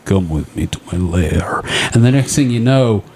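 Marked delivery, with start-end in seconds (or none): 0.1-1.6 seductive Sasquatch. Pretend. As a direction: purr it low like a beast. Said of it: "he looks at you and goes".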